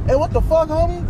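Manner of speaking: high-pitched indignant mimicry; imitated affronted homie, pitched well up; confrontational challenge. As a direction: act out butting into a quarrel. Homie bursts into the quarrel.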